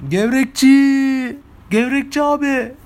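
high, slow, drawn-out call to the vendor. Retold or imitated. Shouting from the window to the vendor with the local word, drawn-out call. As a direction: stretch GEVREKÇİ out long and high, calling down the street. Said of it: "En son dedim".

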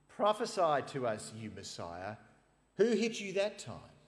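loud, high taunting mockery; mock him as you strike; jeering. Guards jeering at the beaten Jesus, loud taunts.

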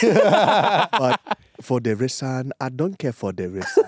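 put-on African-accented English catchphrase. Co-host carries on his impression, mock African accent. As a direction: do the African accent on each word. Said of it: "'Cause you did the accent so well?".

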